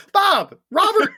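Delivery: high, loud comic cries; mock shouting. Host joins the riff on dad's name, mock shouting. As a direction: shout each name as a pained exclamation.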